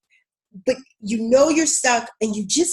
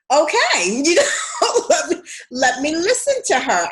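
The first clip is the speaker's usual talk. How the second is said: high, louder, giggling okay; laughing concession; 6 semitones higher, 3 dB louder than usual. Retold or imitated. Recalling giving in to her friend, laughing high. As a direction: laugh it off and give in. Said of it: "And I was like".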